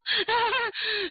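high, forced giggle; nervous laugh. Acted. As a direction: laugh awkwardly to cover a lie. Frightened maid covers with a laugh. (心虚地尖声干笑)

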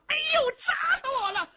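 high, loud yelling wail; loud wailing cry. Acted. The hammer warrior loudly fakes being crushed to his foe, wailing. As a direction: howl that you've been crushed, with a crying shout. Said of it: "黑如虎高声喊嚷".

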